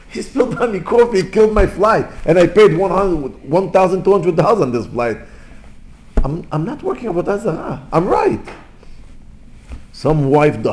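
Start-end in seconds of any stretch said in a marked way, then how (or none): 0.1-5.2 high-pitched, aggrieved passenger; worked-up, high mock outrage; angry complaint. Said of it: "you get so angry on this waiter". 6.2-8.4 angry self-defence. Acted. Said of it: "you get so angry on this waiter".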